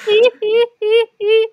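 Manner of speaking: high, loud giggle "hee, hee"; giddy giggle. Pretend. The host plays the smug new owner of the bridge and giggles high and loud. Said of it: "You're like".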